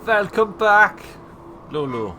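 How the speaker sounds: loud mock German greeting; German accent. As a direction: boom the welcome in a German accent. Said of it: "I sometimes speak in a slightly German accent".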